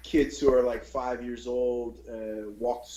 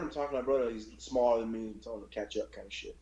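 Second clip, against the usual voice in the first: ordinary talk, nothing marked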